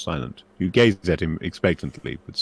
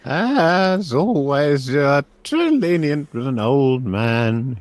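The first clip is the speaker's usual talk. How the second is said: put-on high, very slow, loud old man; high-pitched elderly gratitude; tearful old professor; 8 semitones higher, 6 dB louder, much slower than usual. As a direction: draw it out like a moved elderly magister. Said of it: "wiping away tears with the back of his hand".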